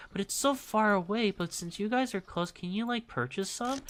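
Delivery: high-pitched mimicry of a pleading customer; mimicking a customer. Mocking a regular customer who asks for a favour, in a high voice. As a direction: ask sweetly for a favour in a high voice. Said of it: "people who would just be like".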